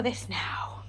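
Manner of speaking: whispered glee before eating; hungry whispered aside. Real, not acted. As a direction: breathe it like a guilty secret.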